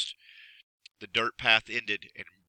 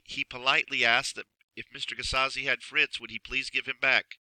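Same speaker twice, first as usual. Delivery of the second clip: usual speaking voice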